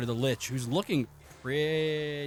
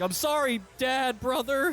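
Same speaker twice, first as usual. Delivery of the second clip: much higher, tearful plea; conflicted hunter cries out to his brother, much higher; crying apology; 11 semitones higher than usual. Acted. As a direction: break into a high weepy apology. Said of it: "the hunter's crying".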